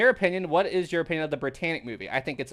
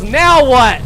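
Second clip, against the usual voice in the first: retold yell; 9 semitones higher, 15 dB louder than usual. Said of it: "and Rose yells".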